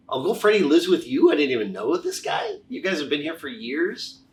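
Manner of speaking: high-pitched incredulous questioning; retold disbelief. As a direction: question them in amazement, starting loud. Retelling his disbelief at the family.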